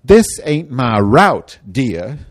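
loud, slow, sarcastic brush-off. Retold or imitated. Driver sneering at a rider, loud and sarcastic. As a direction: drawl it out with contempt. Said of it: "to tell people sarcastically".

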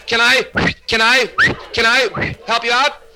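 high, sputtering stammer with long catches; stuttering psychiatrist. Acted. Psychiatrist greets a stuttering patient, stuttering himself. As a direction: get stuck noisily on each restart. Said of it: "has got the same trouble".

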